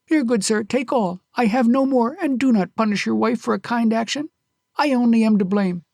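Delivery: high, loud pleading; desperate offer. Acted. Kyusuke pleading with the robber to spare his wife, desperate. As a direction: beg him to take all.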